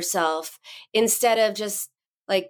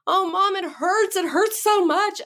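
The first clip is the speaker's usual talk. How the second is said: put-on high-pitched whining kid; mock-pained little kid complaint; hurt child; 11 semitones higher than usual. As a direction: imitate a hurt child wailing to mom. Daughter crying over skinned knee.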